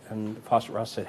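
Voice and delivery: normal talking voice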